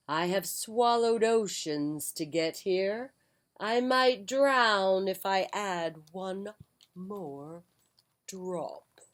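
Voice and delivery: slow, grave and mysterious; mysterious old wizard. Acted. Wizard refusing water, slow and mysterious. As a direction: draw out each word like an old riddle. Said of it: "said very mysteriously".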